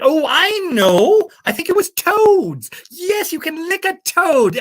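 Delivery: high, stretched-out eureka; sudden delighted realisation. Acted. Host remembering it was toads, sudden excited realisation. Mock realisation about the fact.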